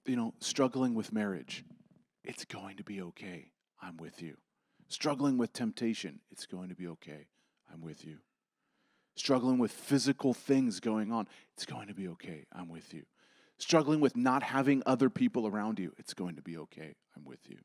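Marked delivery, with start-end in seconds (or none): 2.2-4.4 much softer and lower, gentle comfort; hushed voice of Jesus, tender; tender reassurance. Acted. 6.3-8.2 much softer and lower, gentle comfort; hushed voice of Jesus, tender; tender reassurance. Acted. 11.5-13.0 much softer and lower, gentle comfort; hushed voice of Jesus, tender; tender reassurance. Acted. 16.0-17.6 much softer and lower, gentle comfort; hushed voice of Jesus, tender; tender reassurance. Acted.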